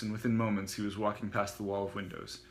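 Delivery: usual speaking voice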